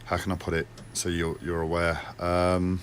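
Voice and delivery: breathless and panting between words; out of breath. Real, not acted. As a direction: speak while catching your breath. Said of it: "I'm just catching my breath".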